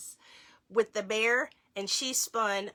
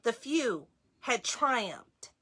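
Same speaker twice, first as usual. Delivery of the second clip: lively read-aloud, slightly higher; reading to children; 4 semitones higher than usual. Acted. Parent reading a Hanukkah book to children, lively read-aloud. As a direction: read the book brightly to the kids.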